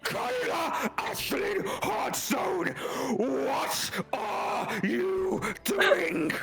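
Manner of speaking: slow, high mother's shout; full-name scolding. Acted. Mother calls her daughter by full name, slow. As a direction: draw out the full name in anger.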